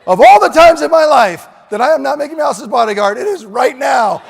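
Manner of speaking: loud, high mock-indignant shout; hammed-up outraged yell; yelling at kid. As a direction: bark it as the self-important hero. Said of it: "I yelled at the kid".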